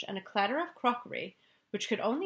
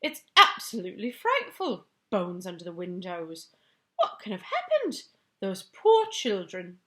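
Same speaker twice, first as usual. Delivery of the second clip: raised, louder voice of a shaken queen; a queen shaken by a nightmare, pitched up; upset Queen; 6 dB louder than usual. Acted. Queen dismayed by news.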